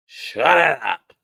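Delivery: slow, stretched, swelling "shut it up"; exasperated dismissal. Real, not acted. Vlogger fed up with Dorit, slow exasperated dismissal.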